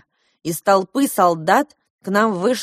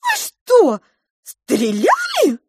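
very high, loud squeaky question; aghast little mouse; shocked mouse; 5 dB louder, much slower than usual. A narrator's reading. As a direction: squeal it in disbelief.

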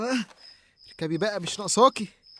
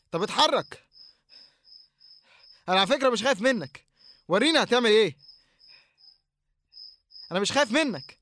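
defiant shout; 3 semitones higher, 4 dB louder than usual. Acted. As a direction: shout him down to seem brave. Challenging the figure again. (تحدي بصوت عالي يخفي الخوف)

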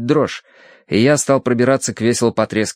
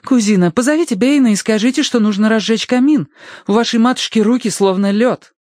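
put-on urgent young lady; 11 semitones higher than usual. A narrator's reading. Verity gives urgent orders.

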